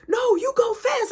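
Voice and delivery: high shout from a distance; shout back. Retold or imitated. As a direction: yell it back over your shoulder. Brother shouting back while riding.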